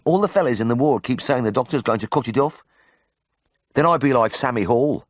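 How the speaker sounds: high, worried Brummie patient; Birmingham accent. A narrator's reading. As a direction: explain anxiously in broad Birmingham. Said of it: "in a ripe Birmingham accent".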